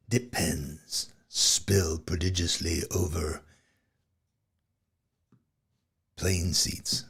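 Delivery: slow, dramatic trailer announcer; In a world, epic trailer style; movie trailer voiceover. Pretend. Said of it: "movie trailer voiceover effect".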